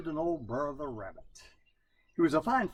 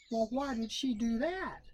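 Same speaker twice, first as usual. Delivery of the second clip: Brer Rabbit voice; 10 semitones higher than usual. A narrator's reading. Rabbit asking coon.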